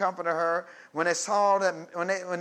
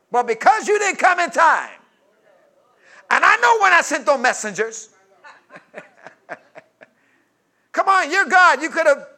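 shouted reproach, much higher and louder; reproachful shout; 9 semitones higher, 11 dB louder than usual. Acted. Mary shouting her reproach at Jesus. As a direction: rail at Jesus, loud and high. Mary's reproach at full force.

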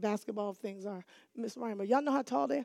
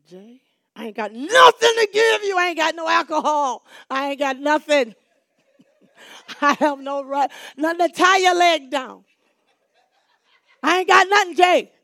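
loud, higher, fed-up pleading; exasperated loud; 5 semitones higher, 12 dB louder than usual. Retold or imitated. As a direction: throw up your hands at the kid. Exasperated teacher to insistent child.

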